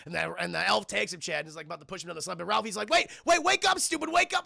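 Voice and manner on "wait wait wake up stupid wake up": high, loud yelling at himself; mock frantic yell, louder and higher; urgent self-scolding. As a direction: snap himself awake in panic.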